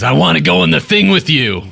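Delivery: eager ally tagging along, voiced up high; Uko's voice. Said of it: "Uko says".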